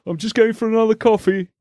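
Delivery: high, prissy mock voice; high mock-posh jibe; mocking brother. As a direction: tease like a smug coffee snob. Brother mocking the cup guy.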